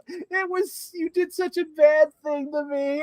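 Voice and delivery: high-pitched, wounded mock whine; hammed-up pitiful accusation; mock guilt-tripping. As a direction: lay on the guilt in a put-on high voice. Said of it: "he makes you feel guilty".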